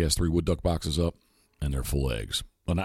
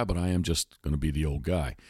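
ordinary talk

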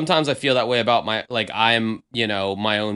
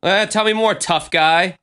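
higher teasing sneer; mock tough-guy taunt; 8 semitones higher, 3 dB louder than usual. Host mocking a tough-guy interviewee, teasing and higher. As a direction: needle him like a playground bully.

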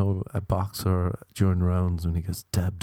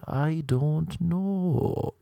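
very slow, higher drawn-out I don't know; drawn-out shrug; 8 semitones higher, much slower than usual. Real, not acted. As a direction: stretch it out, lost. Confused about story point.

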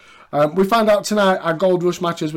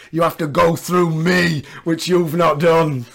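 slow imitation; 3 dB louder, slower than usual. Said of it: "Hunter then delivers this line".

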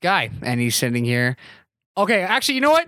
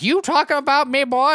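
higher, imitated old lady confronting someone; mock old-woman challenge, pitched up; 7 semitones higher than usual. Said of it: "She'd be like".

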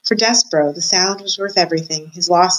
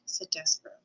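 plain reading voice

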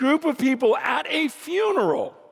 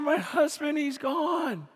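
higher, softer voice of a grieving wife; imitated sobbing; 5 semitones higher, 5 dB quieter than usual. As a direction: gently imitate a widow's sob, without full crying. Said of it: "she's just sobbing".

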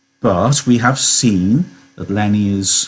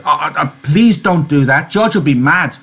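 higher, stammering, panicky plea; begging; 6 semitones higher than usual. Acted. Lenny afraid George will be mad, begging. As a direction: plead with rising worry. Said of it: "he begged".

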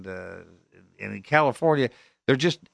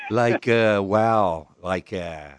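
inarticulate filler-word talker; 3 dB louder than usual. Retold or imitated. Mocking filler-laden young speakers.

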